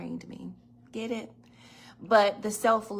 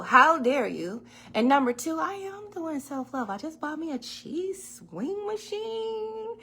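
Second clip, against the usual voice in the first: hammed-up affront, rising higher; mock outrage; 9 semitones higher, 5 dB louder than usual. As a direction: play it offended and proud. Acting indignant.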